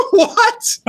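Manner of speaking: high squeaky disbelieving what; incredulous shriek. Retold or imitated. To the rich local people, a shrill incredulous what. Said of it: "I go".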